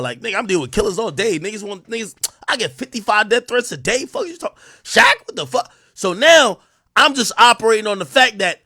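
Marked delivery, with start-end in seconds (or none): none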